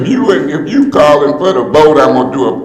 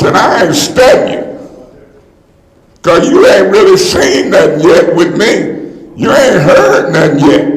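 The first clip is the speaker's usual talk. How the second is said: usual speaking voice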